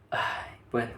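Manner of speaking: breathless whisper of pain. Retold or imitated. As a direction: sigh it out as if it hurts. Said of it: "sientes el dolor y dices". (susurro adolorido)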